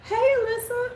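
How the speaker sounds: high, falsetto sugary hello; mock-friendly high hello to a rival; fake sweet greeting. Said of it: "that's what I'm gonna call her".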